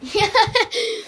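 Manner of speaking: short burst of high laughter; quick high-pitched laugh. Real, not acted. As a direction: break into a brief laugh. Laughs at own antics.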